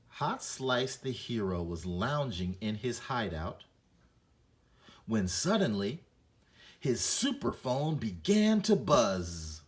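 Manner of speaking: building to a loud, slow dramatic finish; storyteller voice rising with excitement; excited reading. Shown as a demonstration. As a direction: take it up a notch on the exclamation. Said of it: "my voice needs to be a little bit more excited".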